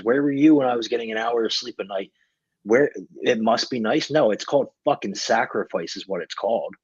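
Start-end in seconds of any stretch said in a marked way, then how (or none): none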